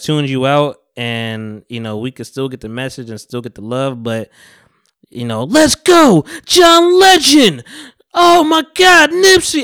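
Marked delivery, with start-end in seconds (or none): 5.4-9.6 very high, drawn-out hollered ad-libs; mock hype-man shout. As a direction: yell it way over the top, stretching the names. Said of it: "it's just too loud".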